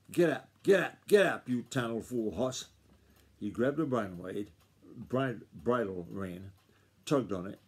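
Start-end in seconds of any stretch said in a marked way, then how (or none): 0.1-2.7 furious yell. Acted. Grandfather yelling at the balking colt, a furious yell. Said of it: "while Grandfather yelled".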